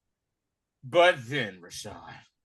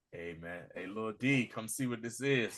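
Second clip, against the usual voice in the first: lowered dad voice; 7 semitones lower, 10 dB quieter than usual. Retold or imitated. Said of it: "he was like".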